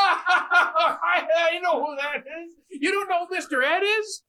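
high, disbelieving squeal of surprise; you can't believe they don't know; incredulous. Real, not acted. Friend stunned nobody knows Mister Ed, incredulous high squeal.

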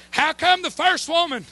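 usual speaking voice

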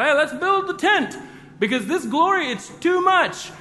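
high, loud, panicked calling out; excited yell. Retold or imitated. Peter yelling to James and John on the mountain, high excited shout. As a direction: shout it out to the others like an overexcited disciple. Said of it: "Peter yells out".

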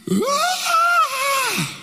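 put-on high shrieking child's "Ah!" over the dead mouse; toddler's wail. Preacher mimics his young son wailing at the mouse, high shriek. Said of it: "my son is just going".

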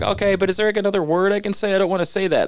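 high, quick uneasy voice; mock nervous protest. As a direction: plead your way out of it. Retold protest, high pitch.

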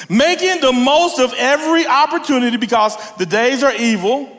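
high, ringing verse reading; emphatic scripture reading. Acted. Reads scripture aloud.

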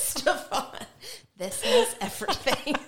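words through laughter; laughing while talking. Real, not acted. Laughing at the review.

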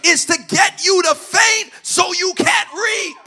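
loud, rising sermon climax; impassioned preaching. Real, not acted. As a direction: preach it upward to a high, shouted finish. Preaches at full force.

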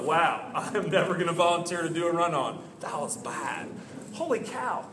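low, muted, unimpressed aside; dropped-voice mock disbelief; mock dismay. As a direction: mimic an appalled onlooker under your breath. Voices unimpressed kids watching a failure.

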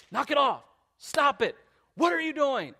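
high, sharp parental scolding. Retold or imitated. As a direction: snap at misbehaving kids. Acting out himself scolding his kids.

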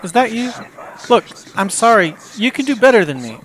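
high, anxious apologizing; nervous pleading. Acted. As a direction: beg her forgiveness nervously. Guy fearing ex-girlfriend is calling.

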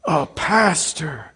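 slow, drawn-out mock reverence; hammed-up awe, starting high; imitated awe. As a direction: stretch each word as an awestruck host. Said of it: "His entire countenance changed".

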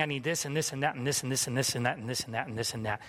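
quick, low run-on list; acted inner chatter, hurried and monotonous; rattled-off demands. Mimicking endless inner demands.

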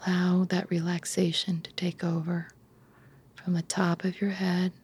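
calm bedtime relaxation, phrase by unhurried phrase; guided meditation. Acted. Leads a guided imagery relaxation.

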